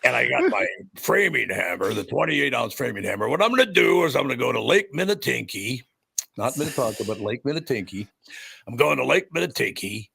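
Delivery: Crusher. Retold or imitated. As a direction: boast like a cartoonish tough-guy wrestler. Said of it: "guys that talk like this".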